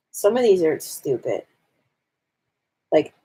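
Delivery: normal talking voice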